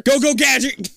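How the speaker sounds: high, loud cartoon call; mock catchphrase shout. Reader riffs on the yelled story line, mock catchphrase shout. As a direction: playfully holler a gadget command.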